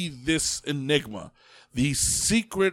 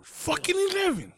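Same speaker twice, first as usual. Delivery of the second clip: high-pitched disbelief; squeaky-high astonishment at the number; incredulous. Real, not acted.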